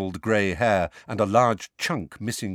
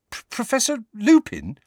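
higher stammering disbelief; disbelieving; 14 semitones higher, 5 dB louder than usual. Acted. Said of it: "he said disbelievingly".

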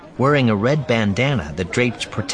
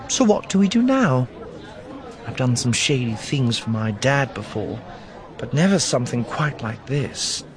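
higher-pitched companion voice; light, higher character voice confiding in a friend; Nakari; 5 semitones higher than usual. A narrator's reading.

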